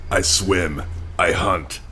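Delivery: ordinary talk, nothing marked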